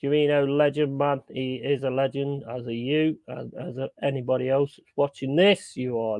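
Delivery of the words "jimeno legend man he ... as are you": ordinary delivery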